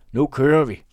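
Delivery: loud call from a distance; shouted from the wagon. A narrator's reading. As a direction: yell it across to someone still lingering. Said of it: "råbtes der fra vognen".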